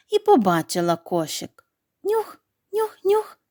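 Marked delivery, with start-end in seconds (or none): 2.0-3.4 high sniffing fox voice, nose to the air. A narrator's reading. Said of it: "Потягнула вона повітря носом".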